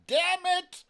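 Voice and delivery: ordinary talk, nothing marked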